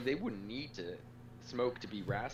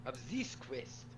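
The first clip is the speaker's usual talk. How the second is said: higher, slow, proud French-chef voice; haughty head chef; 3 semitones higher, slower than usual. Acted. Game chef scolding Magellan, haughty and slow. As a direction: put the upstart in his place.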